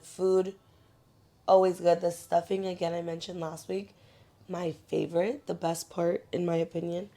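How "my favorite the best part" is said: ordinary talk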